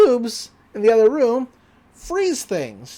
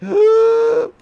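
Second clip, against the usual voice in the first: big, high, loud gasp; thrilled gasp; 9 dB louder than usual. Retold or imitated. Boy invited to help catch the creature, thrilled gasp. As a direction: gasp as if a lifelong wish came true.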